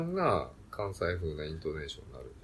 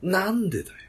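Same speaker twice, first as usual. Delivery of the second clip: high, loud Mimura-style retort; comedian's sharp tsukkomi; 9 semitones higher, 7 dB louder than usual. Retold or imitated. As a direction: shout the straight man's comeback like Mimura. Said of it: "三村さんは".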